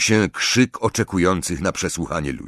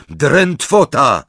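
high, loud, drawn-out incantation; called-out spell; 6 semitones higher, 5 dB louder, much slower than usual. A narrator's reading. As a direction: shout the spell slowly and forcefully. Casting stunning spell again.

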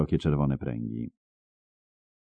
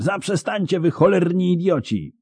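very high, exasperated yell; high-pitched scolding shout; 13 semitones higher than usual. A narrator's reading. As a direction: cry out high at two brawling fools. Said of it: "wrzeszczał Jaskier".

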